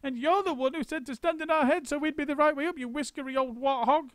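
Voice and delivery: put-on high, furious old woman; screamed. A narrator's reading. Missus Twit screaming back at her husband. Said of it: "screamed Missus Twit".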